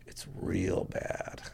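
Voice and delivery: very slow, voiceless whisper; retold grim whisper. Dad answering his son during the raid, grave whisper. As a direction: breathe it out slowly as a dire confession. Dad's grim confidential reply.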